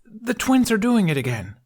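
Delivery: put-on high, worried boy's voice; Fishlegs voice. Fishlegs warning Astrid about the twins, worried. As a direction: sound the alarm, nervously.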